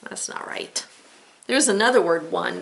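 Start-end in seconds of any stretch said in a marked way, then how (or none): 0.0-0.8 whisper. Acted. Teacher whispers aside.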